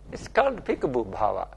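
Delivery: ordinary talk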